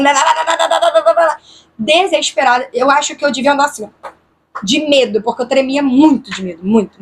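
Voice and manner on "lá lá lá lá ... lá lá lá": rapid-fire looping gibberish, pitched up. Retold or imitated. Frightened woman repeating her prayer in a loop, desperate rapid babble. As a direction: rattle nonsense syllables in a desperate rap-like loop. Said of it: "repeti em looping igual um [name]".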